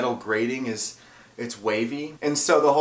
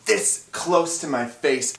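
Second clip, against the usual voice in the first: ordinary delivery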